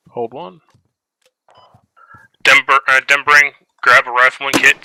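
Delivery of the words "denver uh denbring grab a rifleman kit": loud, calling across the field; shouted orders. Real, not acted. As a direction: bark orders to a distant squad. Squad leader calling orders across.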